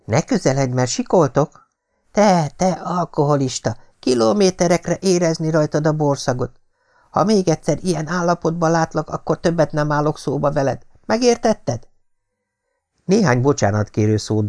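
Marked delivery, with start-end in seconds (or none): 0.1-11.9 put-on high, indignant scolding of a drunk; shrill-free but heated rebuke, pitched high; angry scolding. Patricia scolding her drunk suitor, high and indignant.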